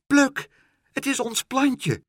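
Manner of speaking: astonished to see the plant; surprised discovery. Acted. Meneer Pen suddenly recognises their plant and cries out to Pluk.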